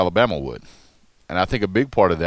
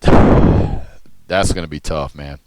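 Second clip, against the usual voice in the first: slow, sighing and louder; weary sigh; 3 semitones lower, 6 dB louder, much slower than usual. Real, not acted. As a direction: drag it out with a tired sigh. Weary repeat of a verdict.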